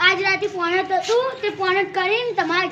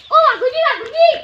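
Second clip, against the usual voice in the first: loud, higher-pitched hail; calling out; 6 semitones higher, 6 dB louder than usual. Acted. Hailing Vaghuji across the way, calling out. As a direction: call the name out to catch his attention.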